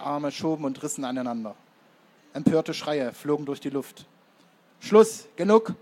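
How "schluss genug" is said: two loud, high bursts like gunshots; commanding shout. A narrator's reading. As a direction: call a halt, each word fired separately. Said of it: "rief der Dickschultrige". (lauter Befehl, wie zwei Schüsse)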